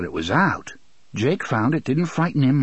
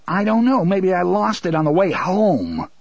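insolent retort; 6 semitones higher than usual. Acted. Son sassing father.

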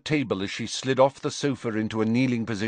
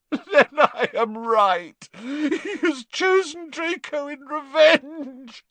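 high, choked, half-sobbing; slow, strangled with grief; choked Narcissa; 4 dB louder than usual. A narrator's reading. Mother realising her son's doom, choked and high. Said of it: "choked Narcissa".

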